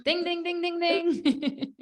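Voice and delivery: high sing-song ding ding ding; playful high correct-answer ding; game-show bell. Pretend. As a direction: chime like a quiz show right-answer bell.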